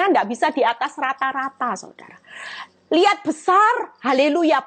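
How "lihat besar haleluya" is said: slow, high, loud cry of wonder; excited exclamation. Real, not acted. Preacher stirring the church, excited high call. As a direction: marvel out loud at the greatness.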